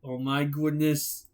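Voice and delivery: ordinary delivery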